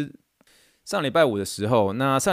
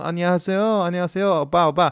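higher, sing-song fangirl hello; mock cutesy greeting; 8 semitones higher, 4 dB louder, much faster than usual. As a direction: parody the lazy tourist-phrase greeting. Mocking a lazy Korean greeting. (高音调、娇嗲地模仿韩语问候)

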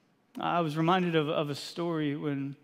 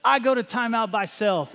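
put-on higher, louder little-girl voice; defiant toddler; 5 semitones higher, 4 dB louder than usual. Daughter marching herself to timeout, higher pert toddler voice. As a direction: pert toddler getting the last word. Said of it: "and is like".

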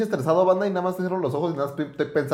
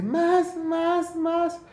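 high, sweet pleading moans; breathy falsetto begging, much higher; angelic voice; 13 semitones higher, much slower than usual. Retold or imitated. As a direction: imitate the neighbor's angelic moaning. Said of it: "güey, angelical".

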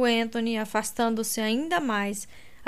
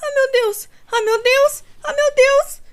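high, loud repeated anguished cries; panicked cries; 9 dB louder than usual. A narrator's reading. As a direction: spiral into panic. Kate panicking in pain.

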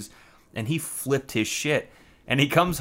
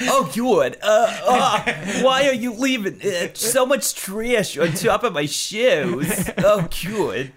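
high, nasal-free whiny mock voice; squeaky put-on roommate voice; high whiny Kyle impression; 8 semitones higher than usual. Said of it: "that's not his voice".

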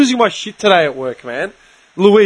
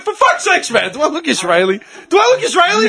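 exasperated retort; 9 semitones higher than usual. Retold or imitated. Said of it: "I went".

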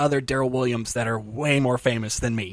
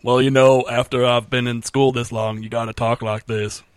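put-on Southern accent; 5 dB louder than usual. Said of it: "talked like Doctor Phil".

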